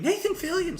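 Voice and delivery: high, loud excited shout; shouting the name. Retold or imitated. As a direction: yell the name across the house. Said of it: "I was just shouting".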